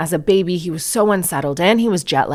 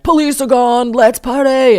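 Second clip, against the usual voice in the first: rowdy partygoers; 7 semitones higher, 5 dB louder than usual. Retold or imitated. Mimicking partying neighbours.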